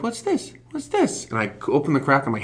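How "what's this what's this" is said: high, squeaky, nosy little monkey; curious monkey voice. Retold or imitated. Voicing monkeys poking at his gear, squeaky and curious. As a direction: go way up like a nosy critter. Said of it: "They're like".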